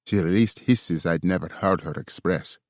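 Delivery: ordinary delivery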